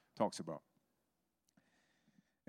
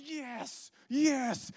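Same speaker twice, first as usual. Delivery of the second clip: high-pitched fired-up cry; Chris; 5 dB louder than usual. Retold or imitated. Imitating an eager evangelist in the car, high excited shouting. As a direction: yell it with comic over-the-top zeal. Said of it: "And he's just like".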